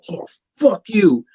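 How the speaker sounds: mock angry crowd. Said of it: "They're like".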